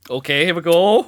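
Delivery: high, loud hype-up; excited cheer. Real, not acted. Cheering on his co-host's final Pokemon pick, loud and excited. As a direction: get hyped for the big reveal.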